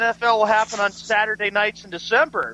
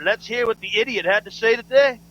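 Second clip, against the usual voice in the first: normal talking voice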